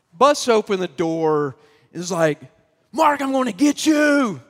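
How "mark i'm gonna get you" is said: high, loud furious shout at Mark; angry threat. Retold or imitated. Classmate Jesse storms in threatening Mark: angry shout. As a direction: yell it like a raging kid bursting in. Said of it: "and he's so mad".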